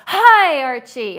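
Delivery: high, loud sing-song "Hi, Archie"; playful greeting. Shown as a demonstration. Teasing the viewer Archie, a loud playful hi. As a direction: call out hi like teasing a friend. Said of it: "I'll say".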